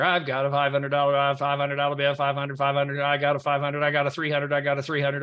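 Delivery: auctioneer. Pretend. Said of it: "I feel like an auctioneer here".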